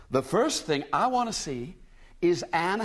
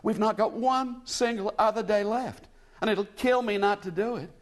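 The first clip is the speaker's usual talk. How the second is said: higher, lost American woman near tears; tearful; 7 semitones higher than usual. A narrator's reading. American lady telling William she is lost, tearful. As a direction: plead on the edge of a sob. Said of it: "she ended in a sob".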